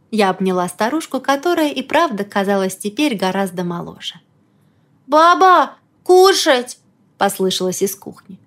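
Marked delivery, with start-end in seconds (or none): 5.1-6.8 put-on high, loud little girl call; childlike voice. A narrator's reading. Childlike Elena calls from kitchen.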